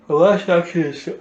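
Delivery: talking through a yawn. Real, not acted. Game master loses her turn summary to a yawn, yawning. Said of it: "sorry, we keep yawning".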